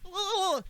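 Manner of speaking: very high drawn-out mock yelp; imitated electrocution cry. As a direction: cry out as if zapped, hammed-up. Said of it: "then Adam's like".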